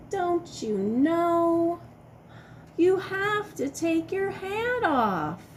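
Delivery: high, slow mock-exasperated question; singsong chiding. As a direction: tease the silly dinosaur. Scolding the silly dinosaur.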